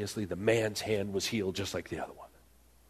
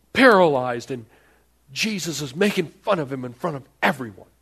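mock outrage; 7 semitones higher, 5 dB louder than usual. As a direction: play the shocked, offended onlooker. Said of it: "for everyone in the room to go".

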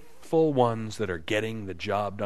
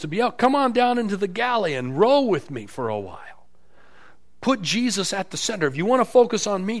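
higher-pitched, forceful urging; cracking the whip; 7 semitones higher, 4 dB louder than usual. Retold or imitated. Paul chastising the church, cracking the whip. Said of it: "cracking the whip at this church".

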